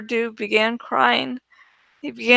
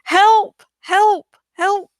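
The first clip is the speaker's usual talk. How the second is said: much higher, louder desperate calls; shouting for help; 8 semitones higher, 5 dB louder, slower than usual. A narrator's reading. Student in the hallway shouting for help, desperate. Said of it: "began to shout".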